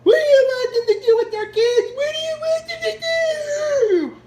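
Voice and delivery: very high, loud fake sob; sob-shouting mock of an overwrought journalist; mock crying whine. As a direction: parody her as hysterically crying. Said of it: "That's like".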